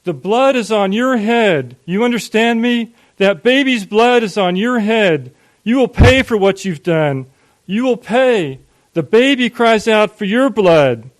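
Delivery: high, full-force shouted condemnation; furious yelling. A narrator's reading. Father outside condemns his son, furious shouting. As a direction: thunder out each accusation like a curse. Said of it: "he yelled".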